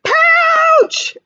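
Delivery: teacher voicing scared Joey fleeing to the pouch; frightened cry. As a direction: yelp it and dive for mama. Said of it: "said Joey".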